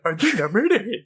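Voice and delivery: mocking, laughing echo of the line. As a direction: throw the line back mockingly. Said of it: "like, mockingly".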